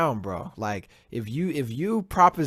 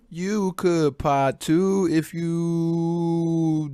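drawn-out taunt; 6 semitones higher, 3 dB louder, much slower than usual. Acted. High stretched taunt.

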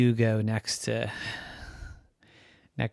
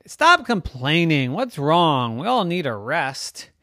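scolding; 4 semitones higher, 6 dB louder than usual. Acted. Neighbor scolds Rocky.

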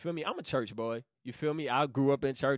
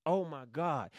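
ordinary delivery

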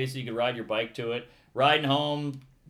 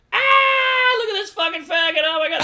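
very high, loud mocking jeer, quick and laughing; jeering teens; 9 dB louder than usual. Teens on a stoop jeering at the drag queen, shrill loud mocking laughter. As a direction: howl with cruel laughter at him.

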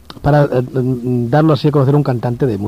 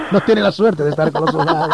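speaking through a laugh; chuckles as he jokes about the soldiers; 11 semitones higher than usual. Real, not acted. Joking about caller with soldiers.

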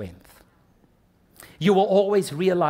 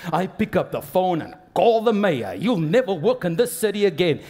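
mock threat; 3 semitones higher, 5 dB louder than usual. Acts out boaster's threat, mock insult.

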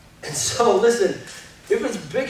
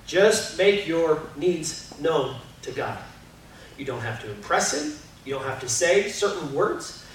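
usual speaking voice

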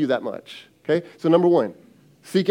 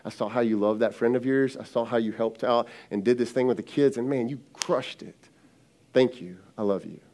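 tender to wife; 6 semitones lower, 6 dB quieter than usual. Retold or imitated. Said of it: "I can look at my wife and go".

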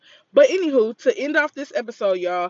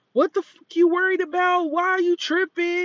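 put-on drunk yelling; 10 semitones higher than usual. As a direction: mimic a furious drunk yelling back. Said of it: "Karen just goes off".

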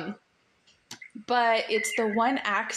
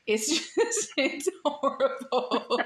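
higher-pitched, words broken by laughter; laughing self-mockery; 4 semitones higher, slower than usual. Real, not acted. Host confessing her awful French R to guest, laughing. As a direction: laugh helplessly at your own failure.

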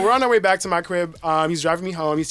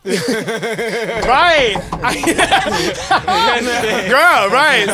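laughing hard, louder and higher; 5 semitones higher, 5 dB louder, much slower than usual. Real, not acted. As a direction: crack up at the joke. Friend's joke cracks her up.

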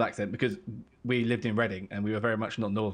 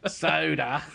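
slow, drawn-out Aussie vowels; playful Australian impression; mock Australian accent; 4 semitones higher, 4 dB louder, much slower than usual. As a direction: relish it in an Australian twang. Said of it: "Aussie, Aussie words".

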